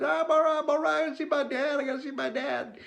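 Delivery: put-on high, piping child voice brushing off help; dazed little boy. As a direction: squeak it out like a stunned six-year-old. Retold child voice after head injury.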